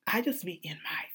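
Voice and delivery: breathy whispered admission. Real, not acted. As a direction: hush it like a secret. Confessing emotional moods, hushed.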